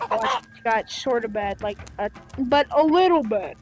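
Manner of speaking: usual speaking voice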